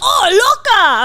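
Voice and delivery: hammed-up flirty call; flirty busboy. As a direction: Hector teasing the waitress. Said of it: "He'd be like".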